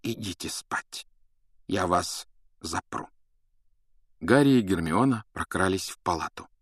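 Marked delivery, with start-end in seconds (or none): 0.0-3.1 put-on soft, slow old wizard; quiet and slow. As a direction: gently send them to bed. Dumbledore sending them off. (тихо и медленно)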